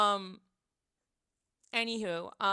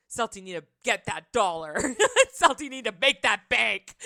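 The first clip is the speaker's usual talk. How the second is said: a lightly voiced wife, louder and cheering; 8 dB louder than usual. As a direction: cheer her on like a proud friend. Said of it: "She was like".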